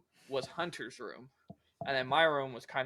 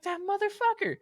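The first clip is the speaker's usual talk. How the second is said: mock outrage. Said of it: "And I was like".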